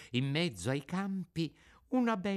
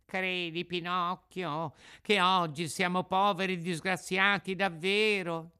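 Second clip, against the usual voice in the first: whining fox; 7 semitones higher than usual. Acted. Fox swears they are truly wretched, whining. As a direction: plead pitifully to be believed. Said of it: "con voce di piagnisteo".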